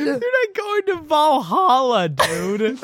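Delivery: high, slow laughing yell; laughing shout. Acted. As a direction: yell it while cracking up.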